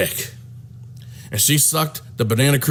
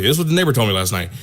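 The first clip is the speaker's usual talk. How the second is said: ordinary talk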